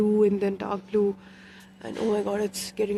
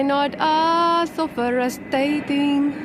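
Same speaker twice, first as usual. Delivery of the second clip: calling out loud on the rooftop; excited outdoor shouting, out of breath; 3 semitones higher, 6 dB louder than usual. Real, not acted. Shouting from terrace, panting.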